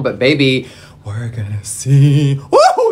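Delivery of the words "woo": high startled woo; shocked squeal. Real, not acted. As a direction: yelp at the splurge. Too much product comes out.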